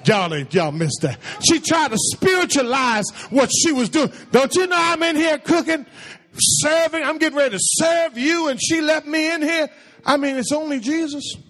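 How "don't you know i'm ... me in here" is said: higher-pitched, aggrieved imitation of a put-upon sister; indignant complaint. Preacher acts out Martha griping to Jesus about cooking, indignant. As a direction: act out her mock-exasperated griping.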